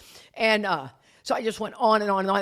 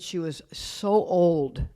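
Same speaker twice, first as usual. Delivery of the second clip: drawn-out amazement; 4 dB quieter, much slower than usual. Retold or imitated. Shocked at stepmother's age.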